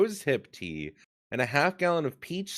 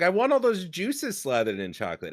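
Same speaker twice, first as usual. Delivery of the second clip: ordinary delivery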